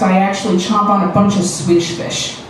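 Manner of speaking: ordinary talk, nothing marked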